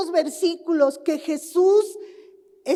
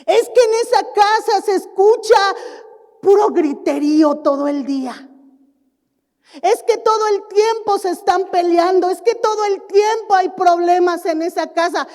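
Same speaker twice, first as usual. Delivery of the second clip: gossiping complaint; 5 semitones higher, 6 dB louder than usual. Acted. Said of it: "Que no digan".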